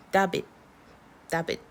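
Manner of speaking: David read by its letters as DAH-bit; spelling pronunciation. Shown as a demonstration. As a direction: say David as clipped "dabit", A as ah. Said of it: "if we were going off of spelling alone".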